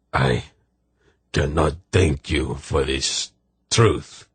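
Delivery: slow, hushed words through weeping. Acted. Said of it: "in the storm of his weeping".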